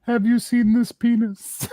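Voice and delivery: high, slow announcer voice on a missing-person poster; mock poster slogan. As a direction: read it like a dramatic wanted ad.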